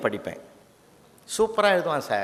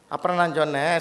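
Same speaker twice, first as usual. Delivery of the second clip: usual speaking voice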